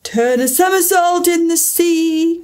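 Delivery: high, drawn-out call; excited cry. A narrator's reading. Mock Turtle calls the next step, crying out. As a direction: cry it out as if capering wildly. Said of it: "cried the Mock Turtle".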